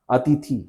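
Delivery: अतिथि with a big ई at the end; long final vowel. Shown as a demonstration. Teacher illustrating the vowel change, mispronunciation demo. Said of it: "बड़ी ई ये हो जाता है".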